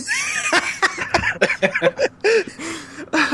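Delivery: long high-pitched laugh; cracking up in a high laugh; high burst of laughter. Real, not acted. Laughing at own joke.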